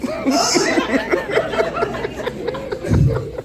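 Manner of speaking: squealing high laugh. Real, not acted. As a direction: burst into a shrill laugh. Laugh after the joke.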